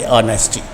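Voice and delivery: soft, low ऑनेस्टी with no h; silent h. Shown as a demonstration. Teacher repeating ऑनेस्टी softly for the class. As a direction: quietly model ऑनेस्टी, starting on o. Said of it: "ऐसे कुछ आवाज़ आएगी".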